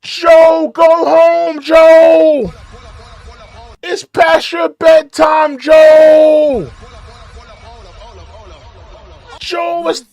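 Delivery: high, loud taunting yell; mock yell. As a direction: holler at him to go home. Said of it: "Somebody should just yell out".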